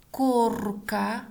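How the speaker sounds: slow word-by-word dictation; one word at a time, long pauses; dictating. Shown as a demonstration. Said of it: "Следующее предложение".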